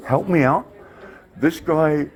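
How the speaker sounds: usual speaking voice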